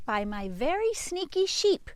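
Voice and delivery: ordinary talk, nothing marked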